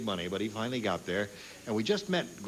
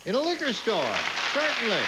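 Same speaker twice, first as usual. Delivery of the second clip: higher, louder, knowing tone; mock certainty; 8 semitones higher, 3 dB louder than usual. Real, not acted. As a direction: play it as obvious. Host mock-affirms the obvious answer.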